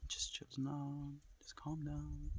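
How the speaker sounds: slow whispered self-soothing; hushed slow whisper to settle nerves. Real, not acted. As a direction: whisper yourself calm after a sigh. Calming himself amid gunshots.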